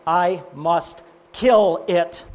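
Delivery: slow, weighted declaration; solemn, deliberate acted verdict; firm insistence. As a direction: spell out the ultimatum word by word. Angel insists on killing lizard.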